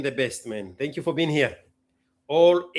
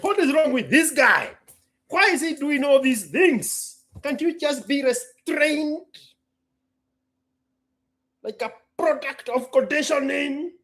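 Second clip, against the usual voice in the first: higher, mocking disapproving voice; mock critic; 7 semitones higher than usual. Quoting a viewer who judges him, higher and mocking. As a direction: mimic a scolding viewer. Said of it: "I was like".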